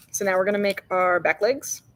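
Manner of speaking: ordinary talk, nothing marked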